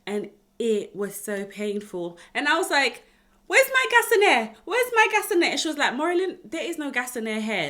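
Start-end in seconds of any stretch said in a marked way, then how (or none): 3.5-5.5 high, loud pained demand, twice; desperate shout. Retold or imitated. Pushing in the birthing pool, desperate high shout for gas and air. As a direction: cry out for the gas in agony.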